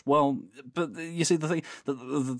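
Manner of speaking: normal talking voice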